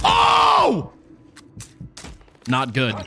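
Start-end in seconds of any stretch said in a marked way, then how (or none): 0.0-0.9 sudden high loud oh; real startled gamer yelp; startled shout. Real, not acted. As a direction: yelp as the shot lands. Gets hit in the fight.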